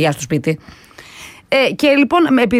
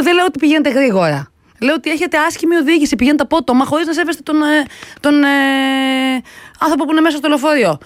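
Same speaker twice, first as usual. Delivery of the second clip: raised, higher-pitched complaint retold to a driver; retold shouting at driver; 6 semitones higher than usual. Passenger arguing with rude bus driver, mock raised voice. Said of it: "Φώναξα".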